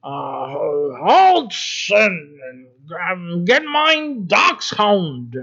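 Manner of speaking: slow, loud mock German command. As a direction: bark it like a comic German officer. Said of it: "And I'll say it in German".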